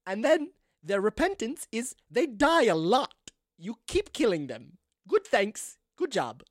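stately, deliberate swooping character voice; grand lady mayor. Acted. Chairwoman explaining the Scream program, grand and deliberate. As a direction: proudly spell out each phrase with pauses.

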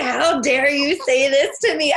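half-laughing, imitated how-dare-you; mock outrage. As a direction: play up the retold fury for laughs. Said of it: "I was so angry".

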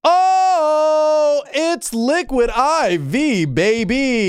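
over-the-top slow product reveal; hyped ad shout. Pretend. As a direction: belt out the sponsor name, drawing it out. Hyped sponsor read call-out.

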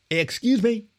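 high, lifted above the din. A narrator's reading. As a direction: call over the noisy room. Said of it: "raising his voice above the din".

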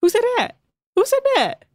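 high sassy housewife voice; imitating a reality TV diva; Real Housewives quote. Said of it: "That's from, uh, Real Housewives of Atlanta".

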